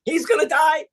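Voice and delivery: startled listener asking if the leader dies; shocked disbelief. Pretend. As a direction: gasp it out in disbelief. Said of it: "shocked listener".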